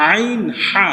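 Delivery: আইন হা said from the middle of the throat. Shown as a demonstration. Teacher showing pupils where আইন হা is voiced. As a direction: squeeze আইন হা out of the mid-throat. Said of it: "হলকের মধ্যখান থেকে".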